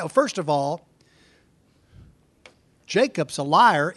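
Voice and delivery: usual speaking voice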